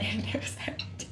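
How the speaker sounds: breathy whisper with a laugh; whispered aside. Real, not acted. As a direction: whisper it like a secret.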